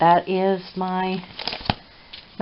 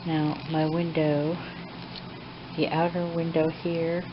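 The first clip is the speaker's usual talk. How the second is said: hushed late-night talk; 12 dB quieter than usual. Real, not acted. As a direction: talk quietly as if it's midnight. Late night, watching the cat quietly.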